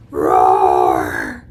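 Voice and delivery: deep, loud growled roar; dinosaur roar. Pretend. Teacher showing kids a dinosaur roar, deep and loud. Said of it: "Can I hear your dinosaur roar?".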